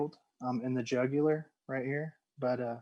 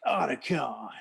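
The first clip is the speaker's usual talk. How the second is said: imitating Snake. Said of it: "He's like".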